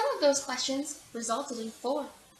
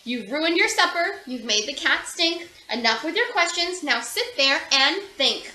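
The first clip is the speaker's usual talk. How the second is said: louder scolding order; stern father; 8 dB louder than usual. A narrator's reading. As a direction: send her off to think. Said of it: "Her father said".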